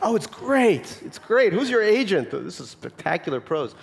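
high, hammed-up gushing praise; mock gushing. Classmates suddenly praising him after the book deal, mock gushing. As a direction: gush with exaggerated delight, starting high and easing down.

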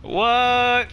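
high, startled "What?"; stunned what. Real, not acted. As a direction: pitch jumps up in disbelief. Streamer stunned by incoming raid.

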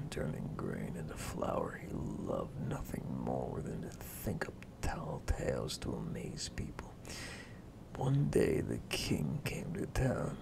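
soft, low, whispered storytelling; whispered bedtime story. Acted. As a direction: whisper the tale to a sleepy child.

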